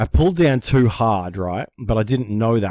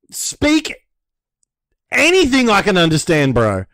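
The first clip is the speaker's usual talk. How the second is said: exasperated; 8 semitones higher, 5 dB louder than usual. Retold or imitated. Frustrated at jargon.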